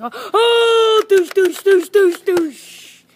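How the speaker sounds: loud, high cry into rapid shot noises; imitated gunfire. Playing master assassin in a pretend battle, loud rapid gunfire noises. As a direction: fire off a loud play-gun volley.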